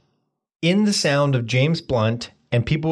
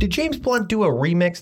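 usual speaking voice

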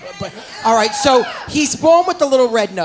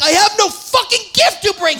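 high, indignant mock rage; still angry, acted; 8 semitones higher than usual. Comic plays drummer boy before Jesus, high acted anger. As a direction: fume it in a raised, comic pitch. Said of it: "He's still so angry".

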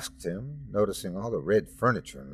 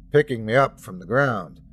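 plain reading voice

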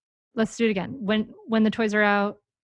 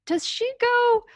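playfully clueless, climbing higher in pitch; bumbling, incompetent parent; 5 semitones higher, 3 dB louder than usual. Acted. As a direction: act comically baffled over where a toy goes. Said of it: "be really bumbling and kind of s-, pretend to be, like, incompetent".

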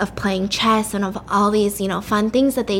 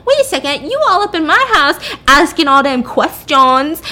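higher, loud, sassy indignation; acted freak-out; 5 semitones higher, 6 dB louder than usual. Drunk woman confronts the man in her house, mock indignant outburst. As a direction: tell him off with exaggerated outrage. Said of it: "she starts freaking out".

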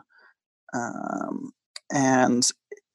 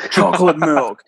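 louder hammed-up ghost impression; mock spook, played for a laugh; spooky ghost voice; 6 dB louder than usual. Host repeating the ghost impression, mock spooky. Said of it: "I'm doing a great impression".

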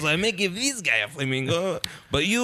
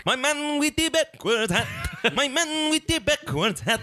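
high, hammed-up Mexican-accented rocker voice; mock Latin rocker, pitched way up; 10 semitones higher than usual. Friend goofing on a bandmate's backwards hat, in a hammed-up Mexican accent. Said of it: "you think that's a [name] impression".

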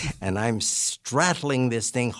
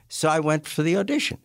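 normal talking voice

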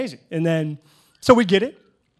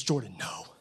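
low, soft mock reprimand; lightly voiced wife putting her foot down; imitated wife's reproof; 6 semitones lower, 6 dB quieter than usual. Wife to husband over the script, imitated reproof.

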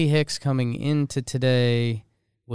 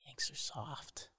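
whisper; 19 dB quieter than usual. Real, not acted. Mocking whispered jab.

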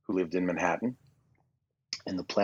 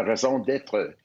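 fake French Canadian accent; 3 semitones higher, slower than usual. Shown as a demonstration. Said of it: "my fake French Canadian accent".

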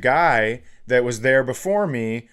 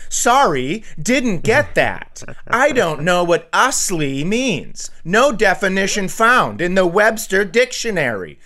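Siri impression; 5 semitones higher than usual. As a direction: play a deadpan phone assistant. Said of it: "And my watch goes".

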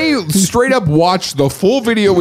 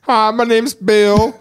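mock caller; 6 semitones higher, slower than usual. Host imitates slow caller.

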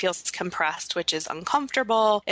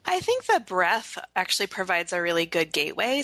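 ordinary delivery

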